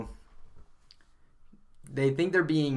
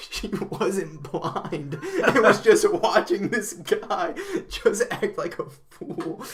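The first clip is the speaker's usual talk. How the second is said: slow, higher, louder, breaking into laughter; laughing through punchline; 8 semitones higher, 7 dB louder, much slower than usual. Real, not acted. Telling the punchline to a friend, laughing through it. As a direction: let the laugh take over the words.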